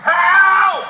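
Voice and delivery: pained shout. Real, not acted. Pinned down by officers.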